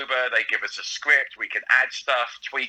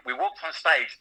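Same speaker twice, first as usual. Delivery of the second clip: ordinary delivery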